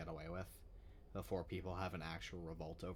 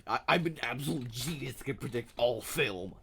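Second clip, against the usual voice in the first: mock arrogant boast; 5 semitones higher, 10 dB louder than usual. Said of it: "and therefore you".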